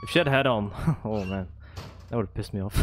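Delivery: usual speaking voice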